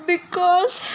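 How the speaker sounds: high fake whimper that cracks into a laugh; fake sob breaking into laugh. Guest fakes a sob for the host, then laughs. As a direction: pretend to cry, then giggle.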